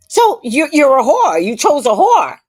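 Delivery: high, loud mock accusation; loud, high-pitched retold jibe. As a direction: imitate her calling you out at full volume. Acting out the woman's insult.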